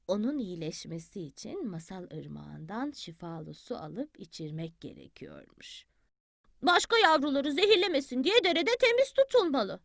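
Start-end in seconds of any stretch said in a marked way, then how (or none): 0.1-5.9 quiet, fading bedtime narration; soft storytelling. A narrator's reading. Grandmother lulls Bili Bili with the tale, very softly. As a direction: let the words sink to a hush.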